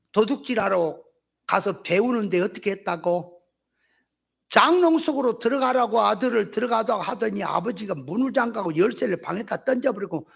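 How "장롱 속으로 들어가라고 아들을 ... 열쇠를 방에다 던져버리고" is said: ordinary talk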